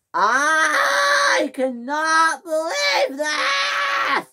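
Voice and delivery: slow, high, crying scream; screaming at full blast. Acted. James screaming in fury at being left alone. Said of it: "he screamed at full blast".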